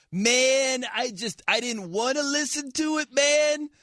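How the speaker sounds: slow, dreamy, spaced-out hippie voice; stoner hippie voice. Retold or imitated. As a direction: drawl it out like a blissed-out rocker. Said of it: "that's what you sound like".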